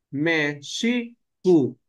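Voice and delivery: Mexicu said slowly, with sh in the middle; Nahuatl Mexicu. Shown as a demonstration. Teacher giving the Nahuatl form of Mexico, slowly. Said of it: "En náhuatl es Mexicu".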